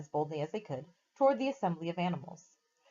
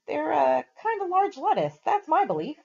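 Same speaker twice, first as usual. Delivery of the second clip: high, louder little rabbit voice; rabbit's opinion; 9 semitones higher, 5 dB louder than usual. A narrator's reading. Rabbit to the animals, a high confident squeak. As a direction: pipe up like a know-all rabbit.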